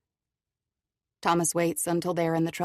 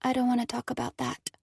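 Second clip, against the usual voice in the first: put-on higher, flat guarded girl; 7 semitones higher than usual. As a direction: shut down, say it flatly. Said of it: "Cora said flatly".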